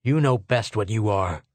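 ordinary delivery, nothing marked

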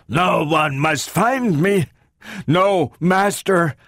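slow, high, strained robot voice; agitated robot, heavily deliberate; desperate robot. A narrator's reading. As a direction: drag out each word insistently. Robot attacks, desperate words.

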